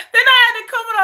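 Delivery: talking through a laugh, fast; quick words through laughter. Real, not acted. Telling viewers her holiday, laughing.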